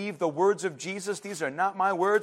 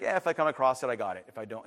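low, soft, couldn't-care-less voice; casual mock indifference; indifferent shrug; 7 semitones lower, 4 dB quieter, faster than usual. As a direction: toss it off, dropping away. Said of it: "you're probably gonna be like".

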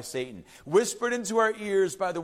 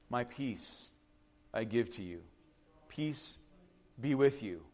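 much softer, lower, hushed blessing; tender Jesus; 9 semitones lower, 10 dB quieter than usual. Acted. Jesus gently blessing the frightened disciples, hushed. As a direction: lay peace gently on them. Voicing Jesus giving peace.

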